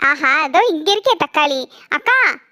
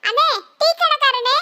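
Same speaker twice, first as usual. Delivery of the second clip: put-on little girl voice; 7 semitones higher than usual. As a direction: speak like a small girl. Little girl calls tea seller.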